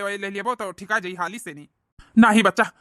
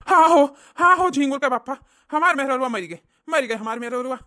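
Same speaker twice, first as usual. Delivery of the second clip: higher, louder wailing lament; mock lament; 5 semitones higher, 7 dB louder than usual. Old man wailing to his neighbor that his wife died. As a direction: wail the news of the wife's death.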